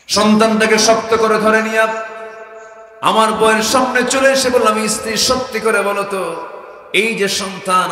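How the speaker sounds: loud, high chanted demand; full-force preaching. Acted. Father confronting his wife over the hidden child, full-force preaching. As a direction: belt it out to the congregation.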